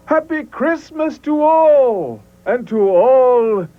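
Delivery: high, slow, ringing holiday farewell; exclaiming farewell. Acted. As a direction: call it out as the sleigh flies off. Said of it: "I heard him exclaim".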